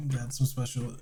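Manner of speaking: usual speaking voice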